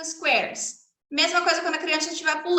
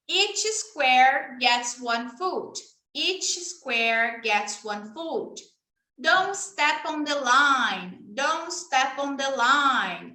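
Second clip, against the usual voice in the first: You lost your turn said very slowly; slow teacher demo; much slower than usual. Shown as a demonstration. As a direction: spell it out slowly for a child. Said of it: "demo for a child".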